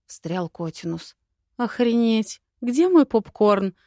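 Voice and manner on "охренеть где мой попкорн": higher and louder, gleefully thrilled; excited exclamation. Acted. Heroine thrilled by the snake lore, exclaiming loudly. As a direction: cry out like the show just got good. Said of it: "воскликнула я".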